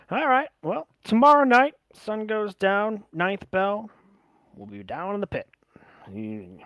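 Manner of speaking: lightly voiced drow, lifting high to announce the time; a touch of showman settling the arrangements; drow fight organiser.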